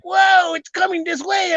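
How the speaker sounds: mock alarm. Friends alarmed at approaching noise.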